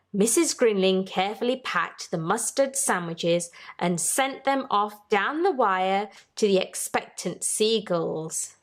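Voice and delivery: plain reading voice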